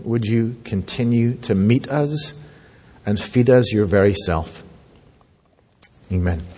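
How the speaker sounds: low, measured praying voice; prayer. Real, not acted. Pastor asking God to meet his congregation, low prayerful voice.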